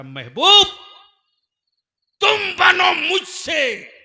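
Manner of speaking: ringing pulpit proclamation; proclaimed divine word. Acted. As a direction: call out to the beloved at full force. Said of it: "तो मेरा रब फरमाता".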